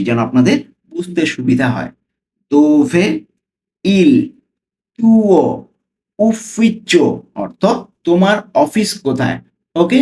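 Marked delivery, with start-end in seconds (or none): 2.5-7.0 slow reading. Shown as a demonstration. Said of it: "slowly পড়ছি".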